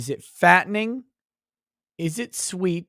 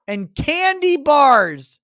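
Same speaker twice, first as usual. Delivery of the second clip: exasperated punchline; 6 semitones higher, 6 dB louder, slower than usual. Real, not acted. Ranting about Midwest recipes.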